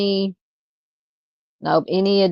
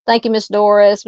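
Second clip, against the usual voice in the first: ordinary delivery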